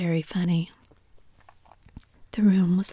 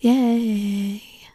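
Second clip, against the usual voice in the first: distant cheer; 3 semitones higher, 5 dB louder than usual. Acted. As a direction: cheer like a far-off crowd. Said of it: "followed by intermittent cries of".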